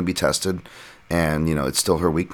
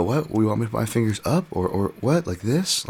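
higher-pitched mock-confused questions; playing dumb; 5 semitones higher than usual. Mimicking John stalling with the ref, playing dumb. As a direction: act baffled, voice jumping up on each question.

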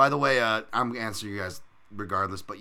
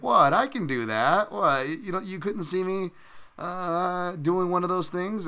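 indignant outburst; 10 semitones higher, 5 dB louder, slower than usual. Retold or imitated. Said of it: "He's like".

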